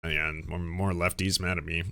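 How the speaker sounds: low, soft grumble to oneself; acting out a resigned brush-off; imitated weary shrug. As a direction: grumble it quietly, like dismissing a post. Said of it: "you see and you're like".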